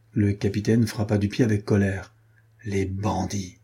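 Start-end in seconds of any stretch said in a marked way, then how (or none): 2.7-3.6 softly muttered curse; murmur. A narrator's reading. Captain curses deserting soldiers, murmured softly. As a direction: mutter it under your breath. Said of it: "murmura-t-il".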